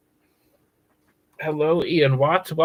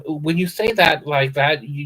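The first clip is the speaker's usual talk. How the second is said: usual speaking voice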